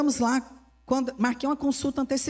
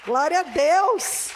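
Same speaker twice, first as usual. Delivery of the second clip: drawn-out praise; 5 semitones higher, 4 dB louder, much slower than usual. Real, not acted. Preacher's praise exclamation.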